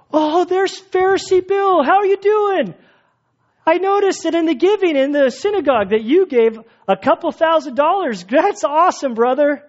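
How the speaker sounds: high, hammed-up admirer; exaggerated high-pitched fawning greeting; mock flattery. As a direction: gush over an important man in public. Mimics public flattery in marketplace.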